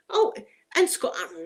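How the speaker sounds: ordinary delivery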